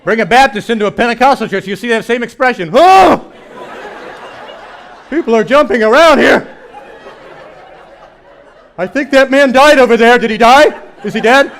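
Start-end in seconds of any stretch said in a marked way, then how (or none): 2.7-11.5 loud, high panicked gasps of a startled visitor; alarmed churchgoer. Retold or imitated. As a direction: sound horrified at the worship around you. Baptist alarmed by Pentecostal worship.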